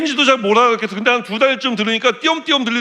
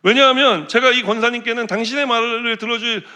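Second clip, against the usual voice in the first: ordinary talk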